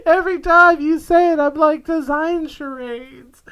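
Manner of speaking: high-pitched, through helpless laughter; real laughter, words stretched out at the end; laughing. Real, not acted. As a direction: squeeze the words out while cracking up. Laughing at co-host's odd word.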